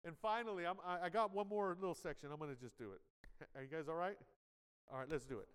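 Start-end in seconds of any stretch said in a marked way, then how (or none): none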